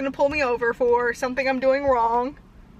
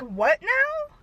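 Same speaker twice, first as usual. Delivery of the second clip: puzzled cop; 9 semitones higher than usual. Retold or imitated. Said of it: "And he's like".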